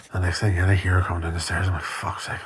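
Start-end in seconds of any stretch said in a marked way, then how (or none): 1.8-2.4 muttered curse. Retold or imitated.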